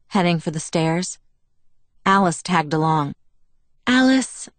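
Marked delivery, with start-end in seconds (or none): 3.8-4.5 higher, sighed-out name; exasperated sigh. A narrator's reading. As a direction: sigh the name as a mild protest. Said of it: "I sighed".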